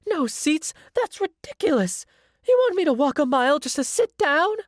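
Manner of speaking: high, shrieky complaint from an irate guest; very high-pitched customer outburst; outraged scream. A narrator's reading. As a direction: scream it high in indignant disbelief. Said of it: "She screams".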